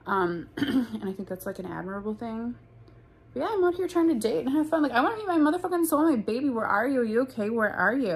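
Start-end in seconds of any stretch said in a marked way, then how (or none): 3.3-8.1 high, loud mock plea; hammed-up loud appeal; playful calling out. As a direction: call out to a missing soulmate, pitched up. Calling out to a future soulmate.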